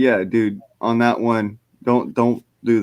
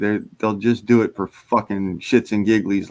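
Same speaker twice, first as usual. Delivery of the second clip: ordinary delivery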